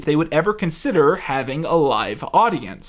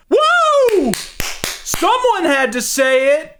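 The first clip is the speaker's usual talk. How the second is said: mock cheering; 12 semitones higher, 3 dB louder, much slower than usual. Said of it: "everyone else around them starts going".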